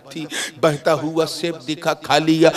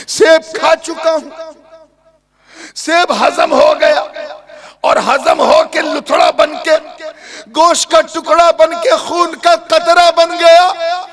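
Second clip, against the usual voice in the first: anguished thinking aloud; 7 dB louder than usual. Acted. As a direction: wail it as a mounting calamity. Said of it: "उस नौजवान ने सोचा". (ऊँची आवाज़ में घबराया विलाप)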